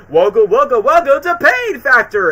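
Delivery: game show host. Pretend. Host opening his show to the audience, booming. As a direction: open the show like a hammy TV host.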